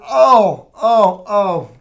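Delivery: loud, rising, breathless oh oh oh; bursting with news she can't hold in; client's excited oh. Retold or imitated. On the phone, client imitated bursting with excitement. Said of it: "she said".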